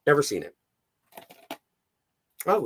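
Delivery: usual speaking voice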